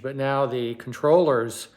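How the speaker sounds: ordinary talk, nothing marked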